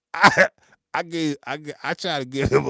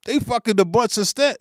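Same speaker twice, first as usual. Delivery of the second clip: ordinary talk, nothing marked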